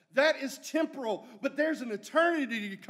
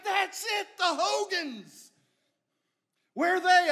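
excited; 7 semitones higher, 4 dB louder than usual. Retold or imitated. Visitor recognizing the old Hogans.

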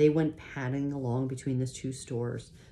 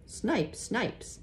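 yelling; 8 semitones higher than usual. A narrator's reading. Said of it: "pointing and yelling".

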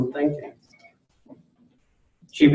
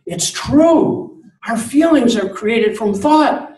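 put-on much higher, bright amazed girl's voice; excited teenage daughter; 8 semitones higher, 3 dB louder than usual. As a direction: play the thrilled daughter telling her dad the news. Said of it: "And she goes".

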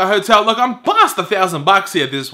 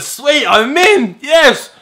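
high, drawn-out celebration; retold little victory cheer; triumphant; 6 semitones higher, 4 dB louder, much slower than usual. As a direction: celebrate with a higher, lifted voice. Retold joy at getting in.

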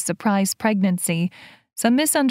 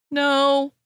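called back from afar; 6 semitones higher than usual. A narrator's reading. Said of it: "he called back".

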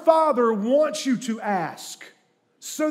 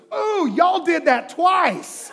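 put-on higher, slow, drawn-out kid's voice; disgusted little boy; 8 semitones higher, 4 dB louder, much slower than usual. Son reacting to his parents, slow and disgusted. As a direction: shudder through it like a grossed-out kid. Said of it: "He goes".

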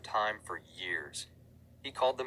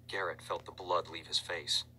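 plain reading voice

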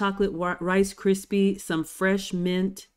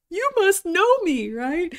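high, loud delighted squeal; excited squeal; 13 semitones higher, 6 dB louder than usual. Retold or imitated. Vlogger thrilled the chef wants phones ready.

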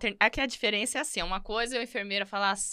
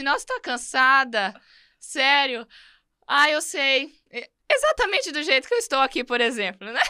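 mock sympathetic mother; 4 semitones higher, 5 dB louder than usual. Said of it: "fala assim".

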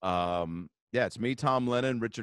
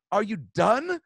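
higher, louder mock impatience; impatient British lady; 9 semitones higher, 5 dB louder, slower than usual. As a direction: ask it like a fed-up Englishwoman. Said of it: "turns back around ... and goes".

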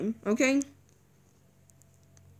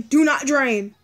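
ordinary talk, nothing marked

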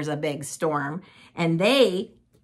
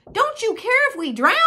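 high-pitched frantic plea; acted alarm, high and urgent; panicked screaming; 13 semitones higher, 4 dB louder than usual. A narrator's reading. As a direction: cry out as a terrified disciple. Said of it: "they screamed".

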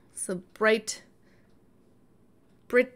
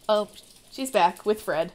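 ordinary talk, nothing marked

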